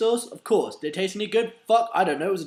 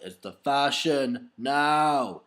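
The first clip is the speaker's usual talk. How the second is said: very slow, drawn-out repeat; 4 dB louder, much slower than usual. Retold or imitated. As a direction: stretch every word out slowly. Repeats the line slowly.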